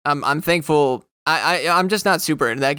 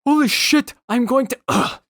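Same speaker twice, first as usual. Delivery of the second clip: stunned excitement; 11 semitones higher than usual. Retold or imitated. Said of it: "he's like".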